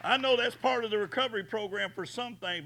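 normal talking voice

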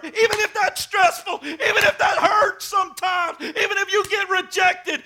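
loud, high preacher's cry; full-force preaching. Acted. The pastor urges the church to tell others of Jesus, preaching at full force.

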